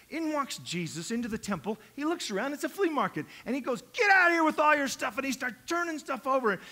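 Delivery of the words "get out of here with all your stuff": high, loud command to clear out; acted angry shout; shouted order. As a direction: yell it as if driving out traders. Jesus clearing the temple.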